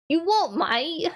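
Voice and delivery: high, drawn-out mock British challenge; British accent. Showing viewers a British phrase, exaggerated British accent.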